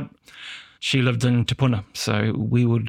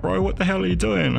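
alarmed; 8 semitones higher than usual. Retold or imitated. Mate alarmed at outburst.

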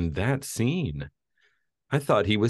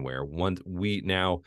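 normal talking voice

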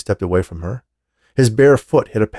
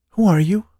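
woman asking; 10 semitones higher, 3 dB louder than usual. A narrator's reading. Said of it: "the woman asked".

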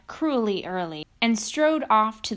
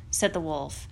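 usual speaking voice

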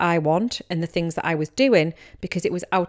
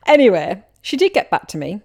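ordinary talk, nothing marked

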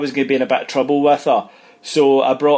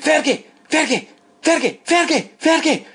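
high-pitched yell of the name, again and again; retold high shout; 13 semitones higher than usual. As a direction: call the player's name way up high, over and over. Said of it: "he was shouting Ian Ferguson".

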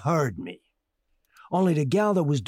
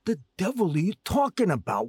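ordinary talk, nothing marked

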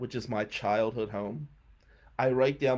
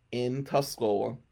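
usual speaking voice

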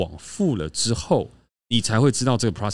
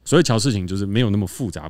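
normal talking voice

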